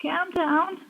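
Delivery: put-on Scouse accent. Echoing her co-host's accent joke, high mock Scouse. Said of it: "The Scouse accent".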